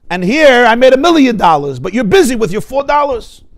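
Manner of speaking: loud, high, wounded pride; angry husband. Retold or imitated. Husband rebukes wife for caring about milk, loud and aggrieved. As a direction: throw his million back at her. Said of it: "He becomes angry".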